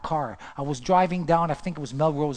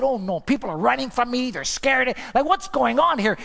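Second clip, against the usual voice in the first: alarmed; 6 semitones higher, 4 dB louder than usual. Retold or imitated. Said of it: "I said".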